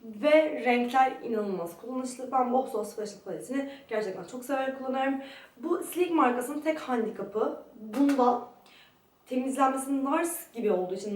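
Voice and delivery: ordinary talk, nothing marked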